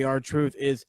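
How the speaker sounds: ordinary delivery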